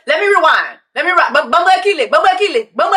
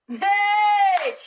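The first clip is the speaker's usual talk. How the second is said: ordinary talk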